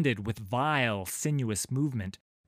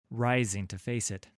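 plain reading voice

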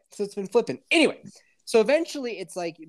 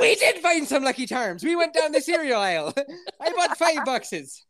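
higher mock leprechaun Irish accent; hammed-up Irish accent; 9 semitones higher than usual. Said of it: "I'll show you Irish".

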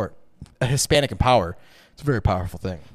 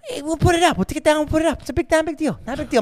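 very high, breezy brush-off; sing-song reassurance, pitched way up; Sebastian Maniscalco; 13 semitones higher, faster than usual. Retold or imitated. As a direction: imitate a contractor waving the problem away. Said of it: "talks exactly like Sebastian, Sebastian Maniscalco".

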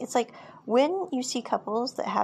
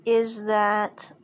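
usual speaking voice

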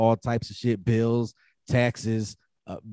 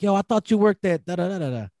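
quoting friend, high; 7 semitones higher than usual. Retold or imitated. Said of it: "He was like".

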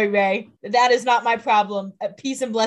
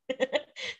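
a sudden squealing laugh, up high; short high-pitched laugh; 7 dB quieter than usual. Real, not acted. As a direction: burst into a high, breathy giggle. Co-host laughs at crowd joke.

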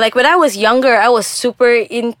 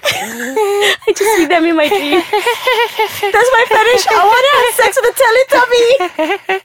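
mock confession; 10 semitones higher than usual. Joking confession in a put-on voice.

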